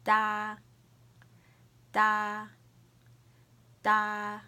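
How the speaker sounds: "Da" held long, loud, high and level; first tone. Shown as a demonstration. Teacher modelling first tone to repeat, high level "Da". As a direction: hold each "Da" on one steady high pitch. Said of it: "the first tone".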